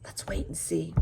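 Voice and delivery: hushed suspense; whisper. Real, not acted. Teacher teasing the class in a whisper. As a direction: whisper it like a secret. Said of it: "teacher whispers teaser".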